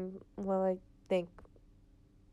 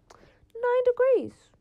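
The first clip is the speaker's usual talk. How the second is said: high, loud, drawn-out disbelief; incredulous outcry; 14 semitones higher, 10 dB louder, much slower than usual. Real, not acted. Vlogger appalled by Melbourne's cold, incredulous high outcry. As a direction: wail it slowly, way up high, appalled at the figure.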